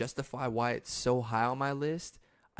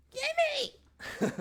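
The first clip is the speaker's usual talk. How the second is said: very high squealing grab; frantic shopper. Retold or imitated. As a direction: screech it like a greedy shopper. Voicing frenzied shoppers.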